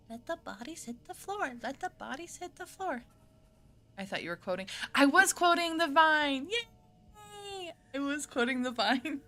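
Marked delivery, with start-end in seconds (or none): 0.1-3.0 high, soft little voice; playful quote. Pretend. Streamer quoting a line about bodies, high soft playful voice. 4.9-6.5 high, loud, drawn-out cry; mock protest. Streamer correcting a viewer about the quote, loud mock protest. 6.5-7.7 very high, soft, drawn-out squeal; tiny high-pitched cheer; squealed yay. Pretend. Streamer after quoting the Vine, tiny squealed cheer.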